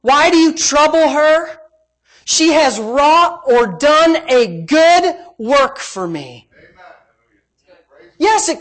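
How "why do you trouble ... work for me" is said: loud, high preaching rebuke, slowed; forceful rebuke. Acted. Jesus rebukes the grumblers, loud and forceful. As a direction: thunder Jesus' defense of her. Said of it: "Jesus said".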